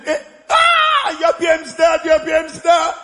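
high-pitched squeal of recognition; excited fan impression. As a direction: imitate onlookers excitedly spotting a celebrity. Acts out people recognizing him.

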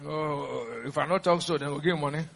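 low, soft imitation of pity-seeking; subdued mock plea, dropped low; mock poor-mouthing. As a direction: play the pitiful excuse-maker, hushed. Mimics believers pleading poverty for pity.

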